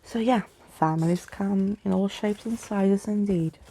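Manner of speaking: usual speaking voice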